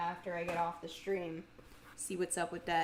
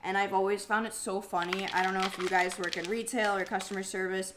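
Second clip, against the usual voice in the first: normal talking voice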